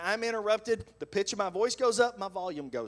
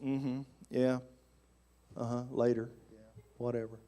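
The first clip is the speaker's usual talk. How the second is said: low, softer dismissive mumbles; acted ignoring; 7 semitones lower, 5 dB quieter than usual. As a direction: brush her off without listening. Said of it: "I'm just ignoring her".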